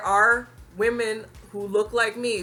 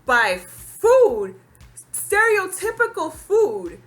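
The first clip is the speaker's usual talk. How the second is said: indignant; 10 semitones higher, 6 dB louder, slower than usual. Real, not acted. Outrage building.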